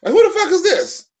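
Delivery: high-pitched, laughing mock confusion; confused high panic. Imitating a too-high person staring at a stranger, laughing confusion. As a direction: play waking up not knowing who's next to you. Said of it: "forget who you having sex with".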